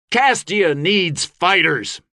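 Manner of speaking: slow, high rallying shout. A narrator's reading. Gabe above the thunder of stamping feet, shouting. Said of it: "he shouted above the thunder".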